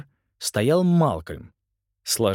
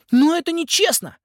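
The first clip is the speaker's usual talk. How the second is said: indignant whine; 6 dB louder than usual. Acted. Nolan protests, shocked.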